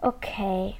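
whispered, giving in; whispering. A narrator's reading. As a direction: breathe a reluctant okay. Finn gives in quietly.

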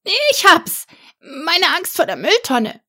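hoher, lauter Ausruf des kleinen Drachen; ausrufend. A narrator's reading. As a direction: ruft plötzlich, freudig überrascht. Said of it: "rief er". (der kleine Drache ruft Max seine Idee zu, hoch und laut)

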